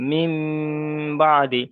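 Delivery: "মিম" held long in a nasal hum before "বাদি"; ghunnah demonstration. Shown as a demonstration. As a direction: hum the final m of "মিম", then release firmly into "বাদি". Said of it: "একালি পরিমাণ গণ্যা সহ পড়তে হবে".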